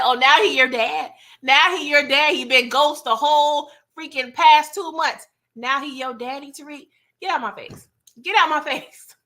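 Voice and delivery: high, loud scoffing disbelief; indignant clapback, half laughing by the end; mock indignant scolding. Mock scolding of show character Tariq.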